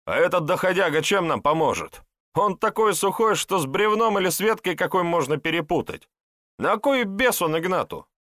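high-pitched scornful protest; indignant objection. A narrator's reading. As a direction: argue against being saddled with a useless helper. Sidorka objects to taking the weakling. (возмущённо и пренебрежительно, высоким голосом)

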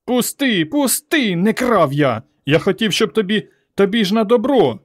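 high, pleading protest; struggling son's high cries; boy crying out. A narrator's reading. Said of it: "Він кричав, відбивався".

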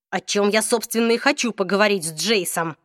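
ordinary talk, nothing marked

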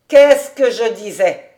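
loud triumphant exclamation; crying out. A narrator's reading. David proved right about Suzel, crying out. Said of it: "s'écria David".

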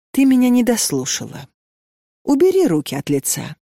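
plain reading voice

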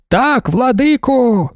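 high, slow fervent reply; women shouting. A narrator's reading. Women answer their lord in a high, drawn-out shout. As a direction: shout back in devoted unison. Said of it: "закричали жінки".

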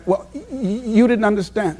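friend's stammer acted out, laughing through it; imitating a stutter. Friend selling to a customer, mock stuttering. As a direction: stretch out each t- sound in a stammer. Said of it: "she stutters".

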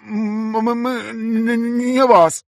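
high, stammering robotic voice; distorted robotic stutter. A narrator's reading. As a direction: stutter through a distorted mask. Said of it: "искажённым голосом, напоминающим роботизированный".

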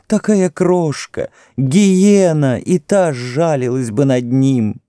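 high, drawn-out wailing prayer; desperate exclaimed plea. A narrator's reading. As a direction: beg the gods to return your child. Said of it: "воскликнула она". (отчаянная мольба, высоким голосом)

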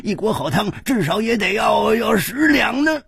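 put-on high-pitched old man haggling; indignant old hawker, pitch climbing at the end; cantankerous old vendor, demanding. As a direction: rattle off the bill as a cranky old man.

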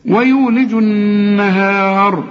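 slow recited ويولج النهار, ending on thick r; heavy r at stop. Shown as a demonstration. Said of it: "مفتوحة فمفخمة". (تفخيم راء النهار في الوقف)

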